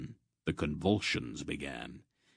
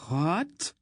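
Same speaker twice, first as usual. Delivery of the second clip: high, rising yelp of disbelief; shocked, high-pitched question; incredulous; 11 semitones higher than usual. Acted. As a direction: cry out as if you can't believe it. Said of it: "Essia cried incredulously".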